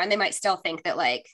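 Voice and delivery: usual speaking voice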